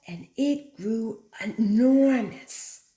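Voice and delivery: enormous stretched slow and loud; drawn-out enormous. A narrator's reading. Teacher showing the class how big, drawn-out emphasis. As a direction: draw out enormous to make it huge.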